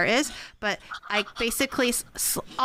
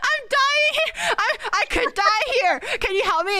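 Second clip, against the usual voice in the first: desperate plea; 14 semitones higher than usual. Retold or imitated. Sister bleeding, begging her brother: high desperate cry. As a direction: beg for help, near tears. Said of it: "I was just like".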